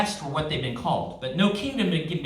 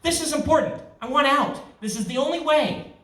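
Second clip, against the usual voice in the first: higher, urgent pleading; insist, pitched up, as he is dragged away; protesting; 9 semitones higher than usual. Acted.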